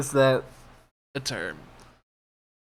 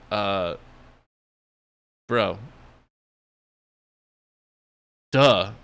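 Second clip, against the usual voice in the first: unimpressed orcs, "duh" punched louder; mock duh; 3 semitones lower, 3 dB louder, much slower than usual. Orcs mocking Galadriel's obvious advice, sarcastic duh. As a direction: play it like she said the obvious. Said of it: "they're all looking at her like".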